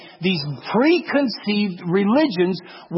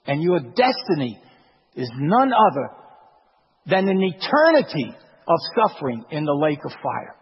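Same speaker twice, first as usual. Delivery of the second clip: ordinary talk, nothing marked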